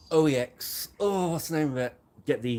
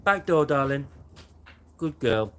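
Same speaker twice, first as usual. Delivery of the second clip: doggy talk; 8 semitones higher, 5 dB louder than usual. Real, not acted. Talking to the dog.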